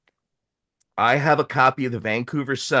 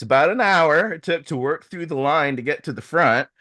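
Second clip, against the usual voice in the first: ordinary delivery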